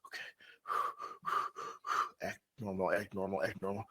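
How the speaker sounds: very soft, low repeated self-reminder; hushed, low stoned self-coaching; panicked self-talk under the breath. Retold or imitated. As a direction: chant it low to yourself, barely audible. Stoned self-talk at dinner, acted.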